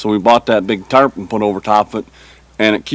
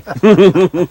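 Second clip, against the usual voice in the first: light laugh; 7 semitones higher, 4 dB louder than usual. Real, not acted. Laughs after Yooper remark.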